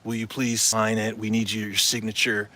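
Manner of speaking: lightly voiced pushy demand, low and soft; a hint of a nagging caller, voice dropped; imitating his way of talking. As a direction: mimic him pestering for the signature, understated. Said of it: "You know how he talks".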